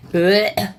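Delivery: short disgusted gag; dry heaving. Real, not acted. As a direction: gag at the yucky slime. Disgusted by slime again.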